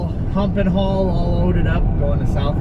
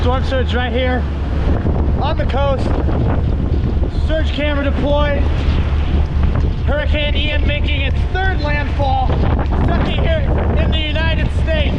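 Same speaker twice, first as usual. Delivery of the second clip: excited; 7 semitones higher than usual. Real, not acted. Filming storm surge at pier.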